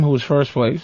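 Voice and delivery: usual speaking voice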